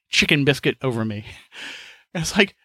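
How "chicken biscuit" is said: ordinary talk, nothing marked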